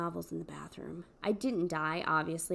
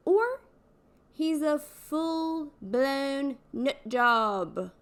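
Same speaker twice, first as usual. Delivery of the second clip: drawn-out punchline; 4 semitones higher, 5 dB louder, much slower than usual. Acted. Punchline about stalker.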